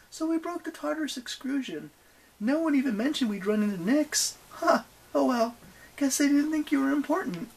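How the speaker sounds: high-pitched dismissive tourist bluff; feigned-casual young heroine. Acted. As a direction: prattle high, slighting the goddess.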